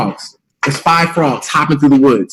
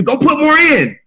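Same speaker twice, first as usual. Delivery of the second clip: mocking people; 10 semitones higher than usual. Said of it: "I used to get pissed when I hear people".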